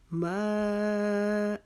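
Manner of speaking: ما with the aa held four alif long; long madd on ma. Shown as a demonstration. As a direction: stretch ما out slowly. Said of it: "اس ما کو ہم نے چار الف کے جتنا کھینچنا ہے". (ما کو چار الف کے برابر کھینچیں)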